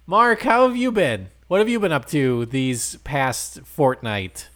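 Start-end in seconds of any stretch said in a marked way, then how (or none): none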